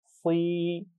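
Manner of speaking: सी drawn out with a heavy s; thick long ee. Shown as a demonstration. Teacher repeats the heavy सी for students: drawn out. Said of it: "सौद की आवाज़ मोटी पढ़ी जाती है".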